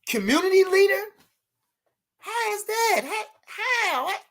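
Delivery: high incredulous questions; playful high disbelief; mock disbelief. Real, not acted. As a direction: act amazed anyone doubts it.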